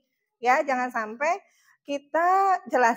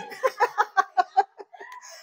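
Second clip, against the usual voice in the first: laughter; 8 semitones higher than usual. Real, not acted. Laughing at own joke.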